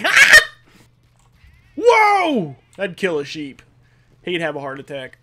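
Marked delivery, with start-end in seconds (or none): 0.0-0.4 imitated high, loud shriek; startled scream. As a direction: scream like someone caught by surprise. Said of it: "he's like".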